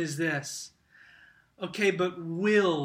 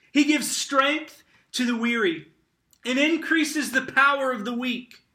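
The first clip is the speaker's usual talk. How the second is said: higher, louder proclamation; emphatic scripture reading; 5 semitones higher, 5 dB louder than usual. Acted. Preacher reading strength for the weary, raised and emphatic. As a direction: proclaim the promise with force.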